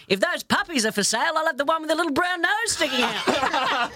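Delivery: put-on high-pitched cheeky old Aussie pop; very Australian grandpa. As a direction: crack the joke as an ex-army Aussie grandad. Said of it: "my pop was very Australian".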